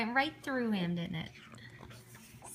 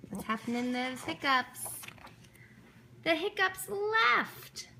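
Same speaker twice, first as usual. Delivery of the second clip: ordinary delivery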